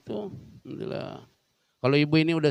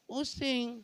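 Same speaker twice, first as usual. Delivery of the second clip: mock dizzy; 10 semitones higher, 10 dB quieter than usual. Acting dizzy husband.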